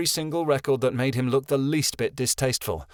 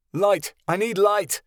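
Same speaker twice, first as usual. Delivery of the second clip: urgent yell; 8 semitones higher, slower than usual. Acted. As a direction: yell for help in the dark. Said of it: "Soren ... yelled".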